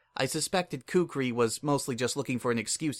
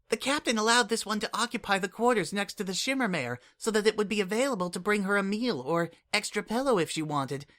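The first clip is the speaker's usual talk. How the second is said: put-on much higher, earnest little changeling kid; Kukri's voice; 8 semitones higher than usual. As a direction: speak up like an eager, caring child. Kukri speaks.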